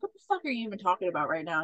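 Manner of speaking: rapid, quiet exasperated snap; fast exasperated. Retold or imitated. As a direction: rattle it off in disbelief. Wife voiced exasperated.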